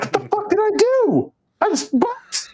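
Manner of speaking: retold panic from a recruit caught off guard; panicked squeak. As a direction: play the terrified trainee, voice jumping up. Panicked trainee approached by instructor.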